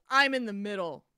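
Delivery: loud gruff demand from the big guy; angry demand. Retold or imitated. Angry man in the aisle loudly claiming his seat. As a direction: bark it like an angry passenger.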